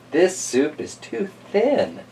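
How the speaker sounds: a hint of a fussy old woman; mild, slow old lady complaint. Old lady voiced in story.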